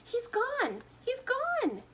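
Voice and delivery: high, loud frantic scream. A narrator's reading. Mother screaming for her vanished son, high frantic. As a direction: shriek it in horror. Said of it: "and she screamed".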